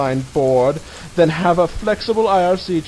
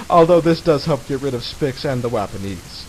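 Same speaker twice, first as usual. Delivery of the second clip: ordinary talk, nothing marked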